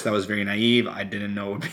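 normal talking voice

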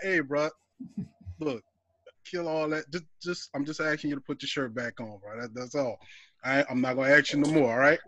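quiet, low, steady bouncer voice; calm low warning. Retold or imitated. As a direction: talk him down calmly, low and even. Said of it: "The dude was like".

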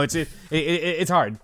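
usual speaking voice

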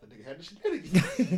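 talking through laughter; laughing. Real, not acted. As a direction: say it while laughing. Joking about the court hearing.

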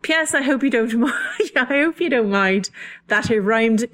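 amused, clumsy Irish brogue; playful put-on Irish accent; garbage Irish accent. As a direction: read the postscript in a bad Irish accent. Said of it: "my garbage Irish accent".

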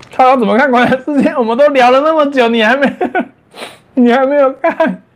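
high, fast disbelief; can't believe they haven't watched; incredulous. Real, not acted. Reading viewer question incredulously.

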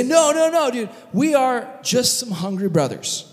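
high, hurried mock pleading; comic panicky denial; flustered protest. Brothers protest the accusation.

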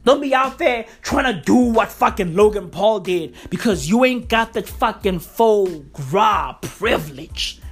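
normal talking voice

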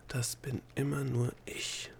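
slow breathy whisper to herself; whispered thought. A narrator's reading. Delia tells herself in thought, in a slow whisper. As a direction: breathe it slowly under the voice, like a private thought. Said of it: "sagte sie sich in Gedanken".

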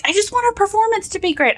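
put-on high fast kid voice; daughter's voice. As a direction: rush it, high and pleading. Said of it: "she's like".

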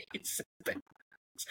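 hushed unvoiced aside; whispering. Real, not acted. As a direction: breathe it like a secret.

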